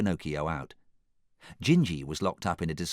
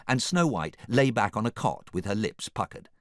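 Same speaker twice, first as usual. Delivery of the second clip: ordinary delivery, nothing marked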